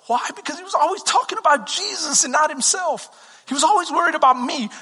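ordinary delivery

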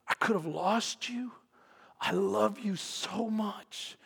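tender, holding back tears; tearful father. Retold or imitated. Father hugging his runaway son, softly tearful. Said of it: "with tears coming down his eyes".

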